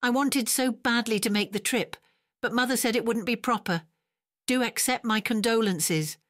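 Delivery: high, sugary fake politeness; sweetly false, not meaning a word; insincere sweetness. A narrator's reading. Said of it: "not an ounce of sincerity".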